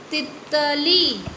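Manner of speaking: तितली with short ति, long ली drawn out; teacher modelling तितली for children; stretched reading. Shown as a demonstration. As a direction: say तितली slowly, stretching the last ली. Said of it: "अभी just पहले हमने लिखा ही था".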